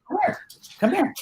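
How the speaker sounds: high, soft coaxing call; real high-pitched pet call; calling the dog. Real, not acted.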